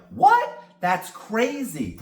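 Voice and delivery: high, hammed-up shocked gasp then slow; pretending to be shocked for a friend; mock surprise. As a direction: fake astonishment, way overplayed. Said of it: "I have to act surprised".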